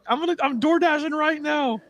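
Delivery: very high-pitched fake sobbing wail; mock crying. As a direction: blubber it like hammed-up happy-shock tears on stream. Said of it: "how you get me to cry on stream".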